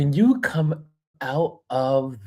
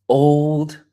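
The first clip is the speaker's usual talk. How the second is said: put-on old held out and louder; old stretched and punched louder; stress on old; 3 semitones higher, 7 dB louder than usual. Shown as a demonstration. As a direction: lean hard on old. Punching the repeated word.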